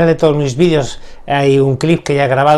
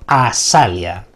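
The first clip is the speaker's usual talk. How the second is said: slow, low spoken spell word; slow incantation; 5 semitones lower, much slower than usual. Shown as a demonstration. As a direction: intone it slowly and low.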